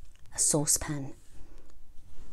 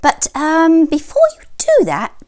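high fluttery hen voice; sweet, high mother hen; 11 semitones higher, 8 dB louder than usual. A narrator's reading. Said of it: "squawked Mother Hen".

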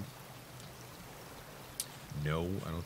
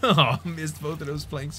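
laughing; 10 semitones higher, 6 dB louder, slower than usual. Real, not acted. Laughs at missing planks.

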